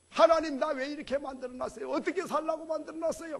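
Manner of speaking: loud, indignant whining at God; mock complaint to God. Preacher voicing a grumbling snake, indignant complaint. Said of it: "그런 소리 안 해요".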